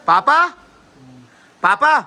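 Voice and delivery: loud high calls to papa; calling loudly to wake papa. Real, not acted. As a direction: call him to wake up. Calling father to get up.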